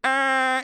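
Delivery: loud, high honking "aah"; sustained vocal honk; car horn imitation. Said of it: "I would honk the horn".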